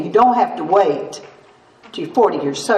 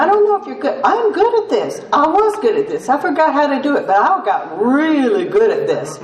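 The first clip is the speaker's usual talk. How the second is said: higher, laughing pride; proudly confessing a talent, laughing; high amused brag; 6 semitones higher than usual. Real, not acted. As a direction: brag about it with a giggle. Bragging about pouting skill.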